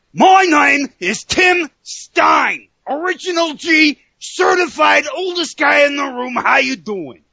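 high, slow shouted announcer yell; yelled hype intro. Acted. Yelling his wrestling intro to the co-host, a big shouted hype. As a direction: bellow your own intro like a ring announcer. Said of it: "That was an accent".

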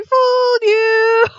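high, drawn-out taunting shout; imitating gleeful gotcha. Prankster pops up at her victims, imitated gleeful gotcha. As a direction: sing it out loud and very high, stretching both words. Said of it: "she starts laughing".

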